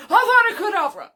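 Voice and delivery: loud, high mock curse-casting shout; shouted spell. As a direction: hurl the spell out loudly. Acting Sirius casting a killing curse.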